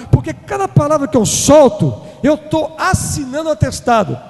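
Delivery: loud, high fiery preaching; preaching at full force. Acted. Preacher warning the church against careless words, fiery shout. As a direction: thunder the warning at the congregation.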